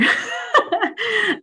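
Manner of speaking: a bright burst of laughter, high; short happy laugh. Real, not acted. Presenter laughing after finishing her PhD, short happy laugh. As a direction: laugh with relief that it's over.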